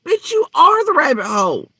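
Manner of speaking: angry outburst. Real, not acted. Ranting at Michelle about rabbit holes.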